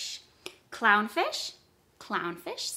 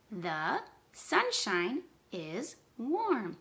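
slow, each word apart; 3 dB quieter, much slower than usual. Shown as a demonstration. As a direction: count out the words with clear gaps. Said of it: "count how many words are in that sentence".

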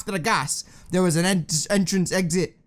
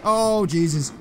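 ordinary talk, nothing marked

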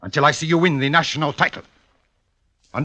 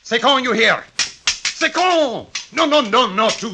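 shouted coaching; 9 semitones higher than usual. Acted. Fencing master drilling his student.

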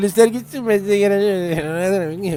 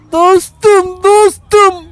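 mock shout; 12 semitones higher, 8 dB louder, much slower than usual. Shouting at NPC.

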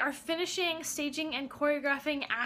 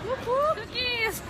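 high, loud whoop of delight; excited cheer; 10 semitones higher, 3 dB louder than usual. Real, not acted. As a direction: cheer for the cookies. Cheering at the cookie shop.

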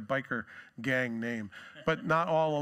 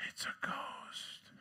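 scared whisper; 11 dB quieter, much slower than usual. Acted. Disciples fearfully suspect a ghost.

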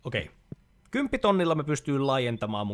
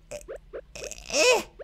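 squeaky startled stammer. Real, not acted. Spotting a snail in the garden.

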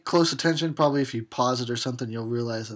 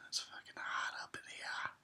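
hushed, much softer complaint; whisper; 17 dB quieter than usual. Real, not acted. Whispering to viewers about the hot room. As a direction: grumble it under your breath.

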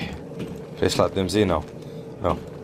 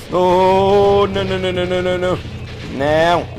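higher, loud rapid no's; panicked no no; 5 semitones higher, 4 dB louder than usual. Real, not acted. Player sees the monster coming, high panicked no's. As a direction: plead in panic.

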